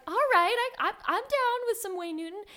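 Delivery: high, bright mock delight; excited mock enthusiasm. Teen at a concert, mock thrilled high voice. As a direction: hype yourself up for a washed-up act. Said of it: "But I was like".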